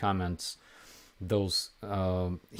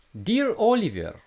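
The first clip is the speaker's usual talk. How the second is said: put-on high, loud Russian babushka accent; squeaky old Russian lady voice; 12 semitones higher, 4 dB louder than usual. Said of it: "I will read it for you in a Russian accent".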